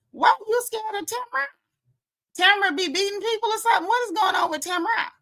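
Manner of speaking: high, fast disbelief; incredulous. Real, not acted. Incredulous rant at Tamara.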